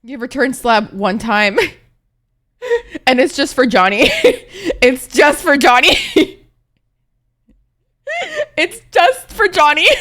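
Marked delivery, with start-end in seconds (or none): 3.1-10.0 words bursting through loud laughter; laughing. Real, not acted. Laughing at own joke.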